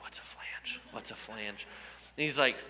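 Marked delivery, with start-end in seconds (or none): 0.0-1.6 inner whisper. Retold or imitated. Panicked thought in his head.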